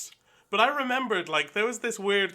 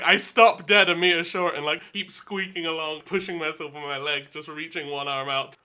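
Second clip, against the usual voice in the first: normal talking voice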